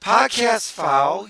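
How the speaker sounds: loud, high, slow call-out; mock referee call. Host calling out co-host's crinkling, mock foul call.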